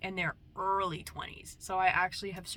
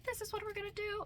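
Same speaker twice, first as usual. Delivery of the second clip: put-on inner child voice; 14 semitones higher, faster than usual. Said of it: "she was like".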